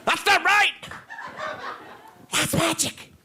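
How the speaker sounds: very high, squealed mock protest; hammed-up disbelief. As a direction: cry out as if the story broke the rules. Acting out outrage at the plot.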